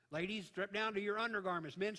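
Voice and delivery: ordinary talk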